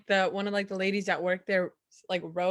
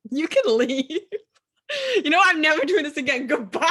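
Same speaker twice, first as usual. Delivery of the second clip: laughing mock exit; 8 semitones higher, 6 dB louder, faster than usual.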